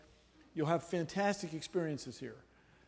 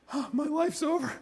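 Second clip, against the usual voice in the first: mock despair; 10 semitones higher, 4 dB louder than usual. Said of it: "a full, you know, comedy beat".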